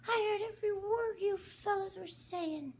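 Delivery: very high, thin sleepy squeak; a sleepy mouse protesting; hoarse feeble Dormouse. Acted. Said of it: "in a hoarse, feeble voice".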